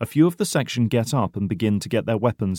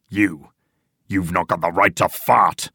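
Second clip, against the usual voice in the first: furious shout; 4 dB louder than usual. A narrator's reading. Old man answers the coffee protest, furious. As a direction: bear down on the insult. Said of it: "shouts the old man furiously".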